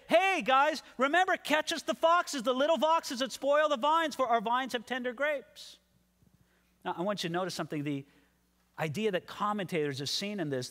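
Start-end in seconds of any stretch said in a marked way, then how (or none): 0.1-5.8 high, casual holler to the guys; crying out. Acted. Maiden's brothers walking by, calling out from the side. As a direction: call it out in passing, pitched well up. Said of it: "cried out from the side".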